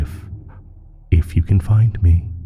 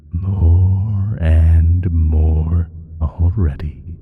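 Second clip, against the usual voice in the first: normal talking voice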